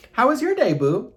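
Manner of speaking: very high, loud singsong; loud, high put-on greeting; playful call-out. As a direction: squeal it out theatrically. Sudden aside in put-on voice.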